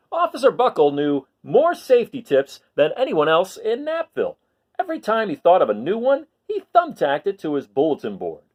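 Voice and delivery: put-on lively read-aloud for children; storybook reading. Teacher reading a picture book to her class, lively read-aloud.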